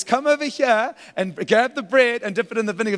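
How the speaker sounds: high, loud playful come-on; flirty invitation. Acted. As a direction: tease it like a suitor calling his date over. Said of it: "he says".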